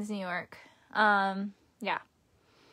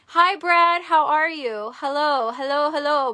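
higher, louder hello to a friend; light up as he walks in; excited greeting; 4 semitones higher, 5 dB louder than usual. Real, not acted. Streamer happily greets Brad joining her stream, bright excited hellos.

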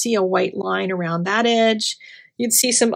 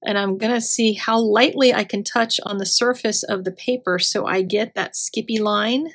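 ordinary talk, nothing marked

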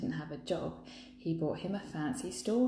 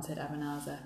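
ordinary delivery, nothing marked

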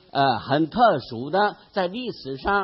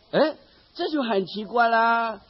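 ordinary talk

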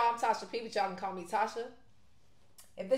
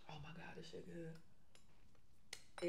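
slow hushed whisper of delight; awed whisper; 4 semitones lower, 14 dB quieter, much slower than usual. Real, not acted. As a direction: breathe it out in a whisper. Tasting food, whispered delight.

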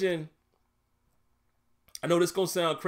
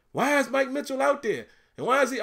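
mock screaming; 9 semitones higher than usual. Imitating father-in-law griping about players, high mock scream. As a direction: imitate an exasperated fan's complaint, pitched way up. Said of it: "He always screaming".

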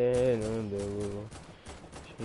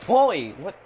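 high, loud startled yelp; startled shout; 6 semitones higher, 13 dB louder than usual. Real, not acted. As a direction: shout it in alarm. Gamer startled by enemy.

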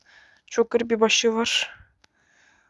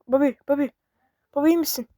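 ordinary delivery

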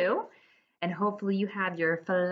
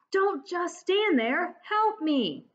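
high, slow whining plea; pleading little brother, higher; whiny kid brother; 9 semitones higher, slower than usual. Acted. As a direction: drag it out like a sulky boy. Arthur caught, demanding help.

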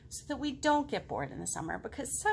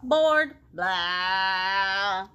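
louder, slow, drawn-out bored whine; loud bored moan; 8 dB louder, much slower than usual.

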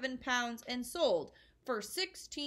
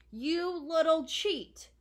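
louder, dragged-out furious yell; sudden shout; 4 semitones higher, 7 dB louder, much slower than usual. A narrator's reading. Father rounding on Matilda, shouting slowly and furiously. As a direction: spit the words out slowly in rage. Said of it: "the father suddenly shouted".

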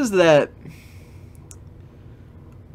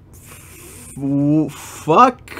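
shocked curse. Real, not acted.

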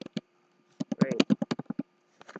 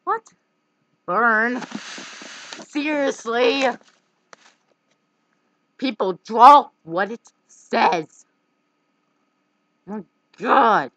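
loud, high exasperated rant; exasperated yelling; 5 semitones higher, 9 dB louder, slower than usual. Real, not acted. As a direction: yell at the bad drawers. Annoyed at bad drawings.

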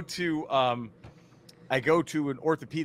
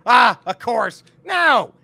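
loud, high dismissive outburst; exasperated doctor; 7 semitones higher, 10 dB louder, slower than usual. Retold or imitated. Doctor dismissing urgent care's diagnosis, loud exasperation. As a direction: throw it out loudly, imitating a fed-up doctor.

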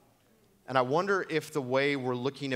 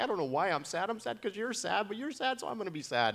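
put-on high, sweet child voice, rising higher; little daughter voice; 9 semitones higher than usual. Pastor voicing his empathetic eight-year-old daughter, high and sweet. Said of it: "She's like".

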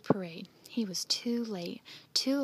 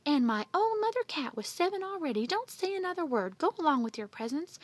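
high, louder scolding; mild scream of indignation; screamed outrage; 7 semitones higher, 4 dB louder than usual. A narrator's reading. Aunt with seven cats sending Andy off, screamed outrage. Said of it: "Aunt Minnie screamed".

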